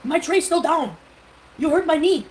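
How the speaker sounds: high, loud imitated outburst; angry French passenger. Retelling a French passenger yelling at the seat in front, angry. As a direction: yell it as the outraged French traveller. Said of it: "And he was French, I could tell the accent".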